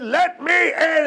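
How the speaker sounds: high, slow demanding call; demanding shout. Acted. Wolf forcing his way in, demanding. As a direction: bellow to be let in.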